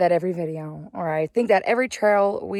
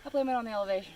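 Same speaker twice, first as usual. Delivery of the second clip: heavy breathing; 7 dB quieter, much faster than usual. Real, not acted. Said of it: "Ignore my heavy breathing".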